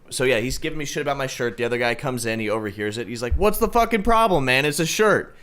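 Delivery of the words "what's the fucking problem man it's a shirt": mock-outraged challenge, raised pitch; retold angry screaming. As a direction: act out an argument, pitched up without real shouting. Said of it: "they start screaming at each other".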